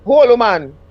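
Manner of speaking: a hint of Jamaican dismissal; Jamaican patois. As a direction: put on his patois plainly. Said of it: "I'm ten years older than him".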